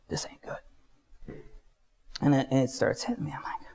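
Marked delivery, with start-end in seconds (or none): 0.1-1.4 slow, barely voiced murmur; uneasy whisper. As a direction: whisper it to yourself in dread. Realizing he is leaving his body.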